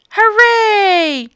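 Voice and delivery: high, loud joyful cheer. Acted. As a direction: cheer with delight. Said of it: "cheered Bob".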